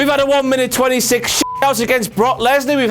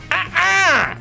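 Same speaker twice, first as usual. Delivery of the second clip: sassy lady uh-uh; 9 semitones higher than usual. Retold or imitated.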